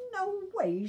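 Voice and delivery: put-on sassy girl voice; bratty refusal. A narrator's reading. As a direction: shoot him down flat.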